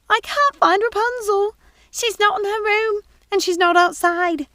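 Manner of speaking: high, loud flustered maid voice; alarmed young housekeeper pleading; high excited servant's voice; Missus Pickle, urgent. Acted.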